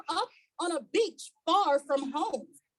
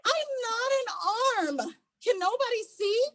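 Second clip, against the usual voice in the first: higher, pleading little stick voice; Stickman pleading; 6 semitones higher than usual. A narrator's reading. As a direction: beg to be seen, high and earnest.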